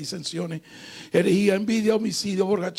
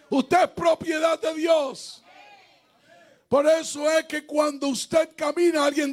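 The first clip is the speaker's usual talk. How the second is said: preaching at full force; 5 semitones higher than usual. Acted. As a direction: preach it out with rising fire. Climax of testimony, shouted preaching.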